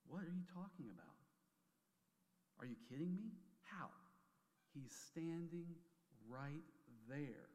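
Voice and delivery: disbelieving outburst, slowed down; mock disbelief. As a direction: play the stunned inner voice that can't believe it. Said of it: "my head just goes".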